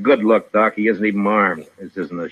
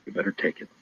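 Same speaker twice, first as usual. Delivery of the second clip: low, near-whispered threat; quiet warning; 9 dB quieter, faster than usual. Acted. Marshal presses the major to accept.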